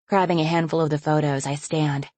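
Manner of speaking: ordinary delivery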